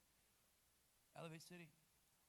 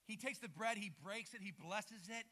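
usual speaking voice

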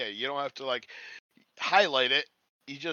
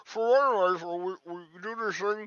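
talking through a muffled mouth; words smothered like a covered mouth; 6 semitones higher, 7 dB louder than usual. Pretend. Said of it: "through a muffled mouth".

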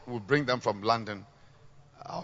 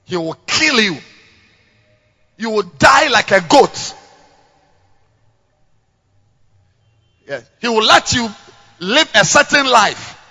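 loud, high fiery preaching; preaching at full force; 9 semitones higher, 13 dB louder than usual. Acted. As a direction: thunder it from the pulpit.

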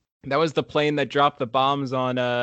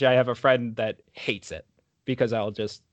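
ordinary delivery

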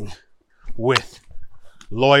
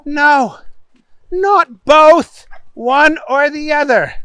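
high, spaced-out correction; exasperated judge; 11 semitones higher, 4 dB louder than usual. A narrator's reading. Judge correcting Booger's double plea, high and exasperated. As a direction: correct him firmly at high pitch. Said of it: "The judge looked at him".

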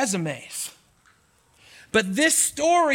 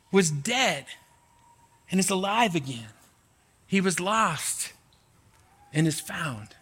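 gentle fatherly voice; 3 semitones lower, 3 dB quieter than usual. Acted. Father gently telling his older son to celebrate, tender. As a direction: reassure the son softly. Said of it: "He says".

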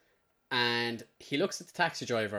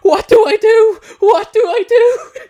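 high, loud mock panic, laughing; panicked; 17 dB louder than usual. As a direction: squeal it like a panicking drunk. Friend panics after reveal.

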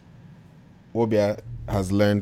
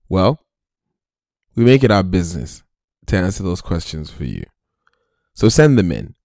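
radio promo read; 4 semitones lower than usual. Acted.

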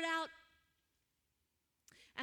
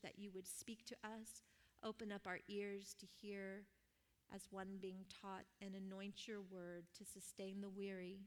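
soft, much quieter earnest prayer voice; 5 semitones lower, 6 dB quieter than usual. Real, not acted. Preacher praying for the listeners before the message, softly. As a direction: pray reverently over the congregation, hushed.

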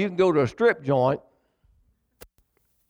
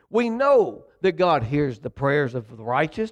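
usual speaking voice

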